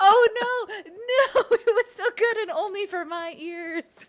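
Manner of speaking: high, loud wail through laughter; laughing dismay. Real, not acted. Streamer learns nobody heard the accent, laughing dismay. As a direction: cry "no" while cracking up.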